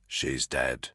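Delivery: quiet. A narrator's reading. Quietly confirming the death.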